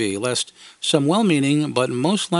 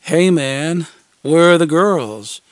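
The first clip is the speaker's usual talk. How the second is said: put-on slow, louder sly teen; edgy coo; 3 semitones higher, 6 dB louder, slower than usual. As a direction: smirk through it. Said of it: "he cooed with an edgy, wicked smile".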